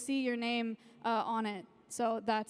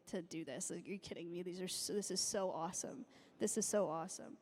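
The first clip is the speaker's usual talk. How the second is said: choked up; 5 semitones lower, 8 dB quieter than usual. Real, not acted. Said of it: "I'll freaking sob on stage".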